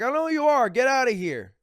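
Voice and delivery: a cop shouting him away; mock cop yelling. Imagined cop telling him off.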